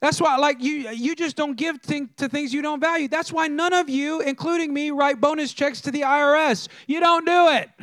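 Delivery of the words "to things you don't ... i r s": ordinary talk, nothing marked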